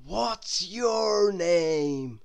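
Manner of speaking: very slow, drawn-out question; slow friendly stranger; Mister Daydream voice. A narrator's reading. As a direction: stretch it out dreamily.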